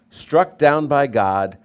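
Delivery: struck down by God, slow and heavy; slow emphatic phrase. Shown as a demonstration. Pastor driving the verse home, slow and weighty. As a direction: lean on every word, slowly. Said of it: "regarded him stricken".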